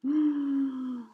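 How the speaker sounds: a sharp gasp of surprise; surprised gasp. Shown as a demonstration. Reader shows Amelia's surprise to listening children, sharp gasp. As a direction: gasp as if caught off guard. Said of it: "Look at her surprised face".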